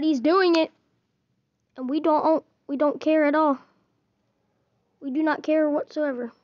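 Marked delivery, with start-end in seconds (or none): none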